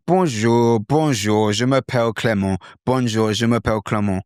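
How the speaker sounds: French greeting in a British accent; English-accented French. Shown as a demonstration. Teacher demonstrating the learner's way, English-accented French. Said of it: "So the bonjour is English".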